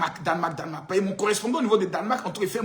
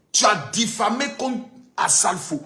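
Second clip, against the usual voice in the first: normal talking voice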